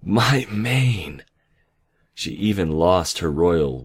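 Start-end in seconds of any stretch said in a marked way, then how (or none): 0.0-1.2 higher, thrilled exclamation; joyful cry. A narrator's reading. Nightmare delighted at her returned mane, joyful cry. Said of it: "she cried out joyfully".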